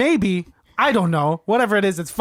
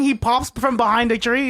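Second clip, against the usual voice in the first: ordinary delivery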